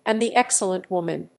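usual speaking voice

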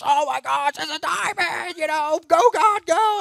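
high, squealing excitement with a catch; excited lady. Retold or imitated. Lady next to him at the meeting, squealing high in excitement. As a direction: squeal it out like she can't believe it. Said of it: "she goes".

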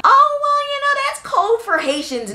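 high-pitched mock objector. Imitating those who'd excuse the cold, mocking. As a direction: imitate a naysayer in a silly high voice.

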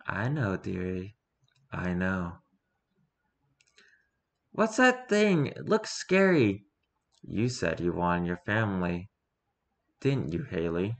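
low, quiet, and quietly threatening; sinister reveal. Acted. Steve leads lost Haley to the monster, low menace.

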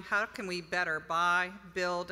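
ordinary talk, nothing marked

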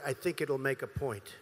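usual speaking voice

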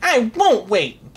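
high, loud, drawn-out defiance; talking back. A narrator's reading. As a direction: defy the king with a petulant cry. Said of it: "talked back to the king".

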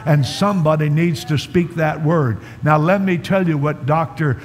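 loud, driving sermon delivery; full-force preaching. Real, not acted. Preacher telling the congregation they are new in Christ, loud forceful preaching. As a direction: preach it hard, hammering each phrase.

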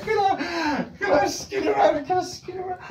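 high, hushed disbelief; incredulous. Pretend. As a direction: repeat the question in disbelief. Incredulous repeat of question.

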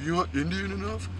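mocking taunt. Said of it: "he's like".

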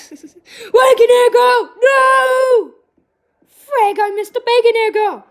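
high, very loud wail of frustration; dismayed shouting. Real, not acted. Player who missed Bacon Egg Girl, dismayed shouting. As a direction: yell as the target slips away.

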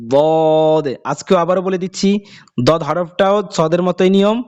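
ordinary talk, nothing marked

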